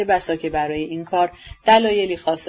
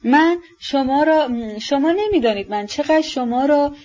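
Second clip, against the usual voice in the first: joyful; 8 semitones higher than usual. A narrator's reading. Ippolit to the woman whose tear he touched, high and joyful. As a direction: stretch the words out high in a childlike rush of joy. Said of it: "شادمانه گفت".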